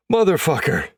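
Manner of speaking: high, loud startled curse; startled yell. A narrator's reading. Player jumped by a teammate from the laundry cart, startled yell. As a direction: yell it at the prankster. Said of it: "I yell as Castro stands".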